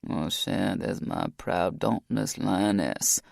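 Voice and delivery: fondly soothing his lover; tender. Acted. Rock tender.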